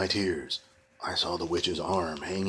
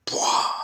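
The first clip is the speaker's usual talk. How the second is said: magic poof sound; 6 dB louder than usual. Acted.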